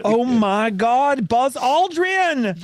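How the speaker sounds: slow, high, delighted cry; excited. Real, not acted. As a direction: stretch it out in delight. Excited about astronaut microphones.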